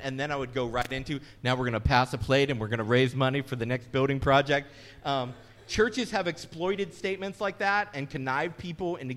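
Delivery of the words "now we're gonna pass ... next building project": louder, hammed-up fundraising preacher; mock pitch for money. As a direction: ham up the plate-passing appeal. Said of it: "I would say that statement".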